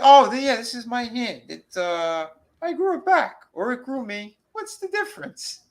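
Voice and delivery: high, squeaky mocking monster voice; hammed-up high demon voice; high creepy hand voice. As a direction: squeak it like the evil hand possessing him. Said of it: "she goes".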